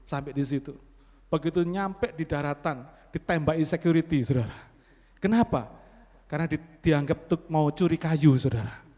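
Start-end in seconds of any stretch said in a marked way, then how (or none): none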